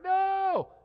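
high, loud mock wail of dismay; mock cry of no. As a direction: play the heartbroken sports parent. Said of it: "You're like".

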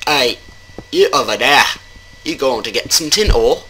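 put-on shaky-aim Swedish accent, called out at someone; bad Swedish accent. Player calling to someone about tin ore, bad Swedish accent. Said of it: "And I just changed from Swedish accent".

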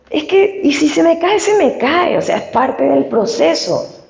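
high-pitched, dismissive retelling; retold shrugging acceptance. Patient telling herself hair loss is part of the process, shrugging acceptance.

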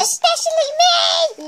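high, loud teasing yell; playful shout. Real, not acted. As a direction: yell it out to tease. Teasing her friend loudly.

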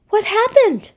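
high-pitched seahorse voice; concerned seahorse. A narrator's reading. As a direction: ask in a high, caring little voice. Seahorse asks Puffy what happened.

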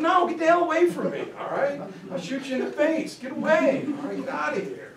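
high, fast angry brush-off; frantic soldier yelling get away. Retold or imitated. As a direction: chase him off, rattled and fast. Soldier shouting enemy away.

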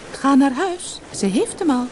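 high, reassuring fish voice; small goldfish with good news; talking goldfish. A narrator's reading. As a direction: send him home in a high little voice. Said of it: "antwoordde het gouden visje".

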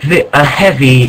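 ordinary talk

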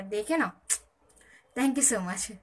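ordinary delivery

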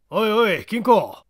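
sharp hail from a stranger; calling out sharply. Acted. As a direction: call him out, gruff. Stranger hails Kinkou at night.

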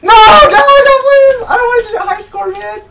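frantic high pleading, fast at the end; panicking gamer. Real, not acted. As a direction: beg the game not to let it happen. Losing the round, pleading.

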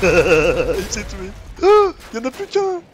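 can't believe he killed himself; excited. Real, not acted. Surprise at enemy suicide.

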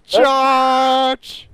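high, loud, drawn-out charge yell; battle cry. Pretend. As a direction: bellow a play war cry into the game.

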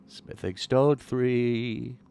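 very slow sing-song item name; slow drawn-out. Pretend. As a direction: stretch each word out.

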